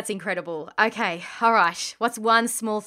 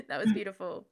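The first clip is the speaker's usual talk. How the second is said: laughing; 10 semitones higher than usual. Real, not acted. Laughing after emotional answer.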